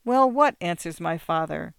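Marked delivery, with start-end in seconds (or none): 0.1-0.6 exasperated. A narrator's reading. Father snapping back.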